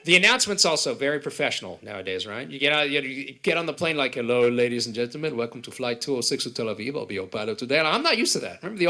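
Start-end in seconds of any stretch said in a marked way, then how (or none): 4.2-7.8 smooth pilot announcement. Retold or imitated. Polished modern pilot announcement.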